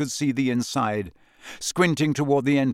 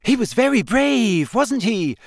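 put-on high, far-from-narrator girl's voice; Hermione; 9 semitones higher, 4 dB louder than usual. A narrator's reading. Hermione watching the player land, admiring high voice. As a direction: voice an admiring girl, pitched high. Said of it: "Hermione said".

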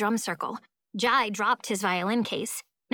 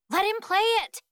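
high-pitched shout; urging shout; 11 semitones higher than usual. A narrator's reading. Amari backs the boy's plea to Rosa, shouting. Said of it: "Amari shouts".

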